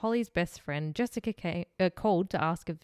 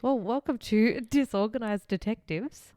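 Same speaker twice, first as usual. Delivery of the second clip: podcast host intro; 4 semitones higher, 3 dB louder, slower than usual. Acted.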